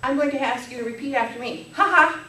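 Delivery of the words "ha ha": louder, higher, deliberate ha ha; demo laugh. Shown as a demonstration. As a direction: model the laugh for the audience. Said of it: "To demonstrate more laughter".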